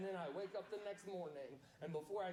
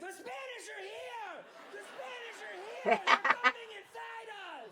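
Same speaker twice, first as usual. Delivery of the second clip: high, rising to loud panicked yelling; Paul Revere screaming in alarm; 11 dB louder than usual. Retold or imitated.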